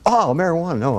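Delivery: catching on at last, mildly amused; sudden realization. Retold or imitated. Young actor finally gets what bong means, surprised. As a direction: pitch jumps up on oh, marijuana.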